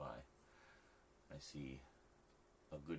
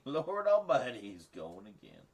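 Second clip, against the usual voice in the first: laughing, loud, high Southern "Lord Almighty", then it drops off; hammed-up Southern exclamation that fades away; 5 semitones higher, 11 dB louder than usual. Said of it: "deeply offensive Southern stereotype accents".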